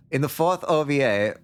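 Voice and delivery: lightly held put-on foreign accent for Nomi; a hint of a foreign accent, eager fan. Chatty kid gushing about a show, light foreign accent. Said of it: "I almost did the accent for the narration".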